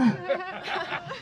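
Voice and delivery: quiet high giggle; soft laugh. Real, not acted. After acting out the bend and snap, soft giggle. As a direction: giggle softly at yourself.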